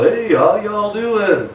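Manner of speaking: put-on Southern accent hello; Southern drawl. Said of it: "moving up from down south".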